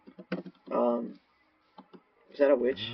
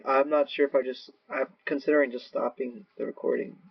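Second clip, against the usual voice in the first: usual speaking voice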